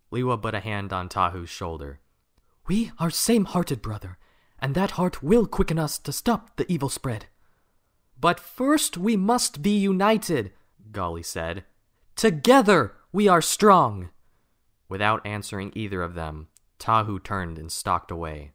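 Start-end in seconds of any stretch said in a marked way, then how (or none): 2.7-7.3 high, lively hero voice; Lewa's voice. Acted. Lewa voiced comforting Tahu. 8.2-10.5 high, slow, earnest heroine voice; Gali's voice. Acted. Gali voiced urging unity. 12.2-14.1 high, slow, earnest heroine voice; Gali's voice. Acted. Gali voiced urging unity.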